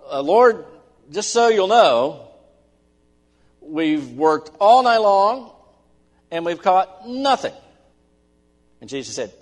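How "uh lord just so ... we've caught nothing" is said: loud, high and slow, protesting to the Lord; a worn-out fisherman loudly objecting; exasperated fisherman. Acted. Peter telling Jesus they fished all night, loud exasperated protest.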